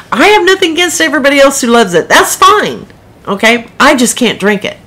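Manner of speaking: high, fast protest rising to an insistent finish; defensive. Real, not acted. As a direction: insist you mean no offence, pitched high. Defending her dislike of Starbucks.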